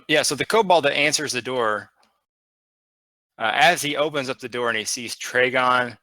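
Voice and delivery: ordinary delivery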